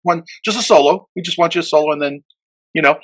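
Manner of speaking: reassuring. Retold or imitated. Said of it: "I'm like".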